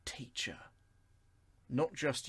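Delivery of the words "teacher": soft reverent whisper; hushed, awed teacher. Shown as a demonstration. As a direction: breathe the word teacher. Reverent naming of Jesus as teacher.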